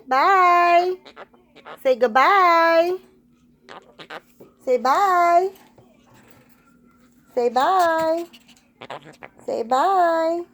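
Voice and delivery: high, drawn-out sing-song goodbye; coaxing a pet. Real, not acted. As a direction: stretch it out high to coax the bird.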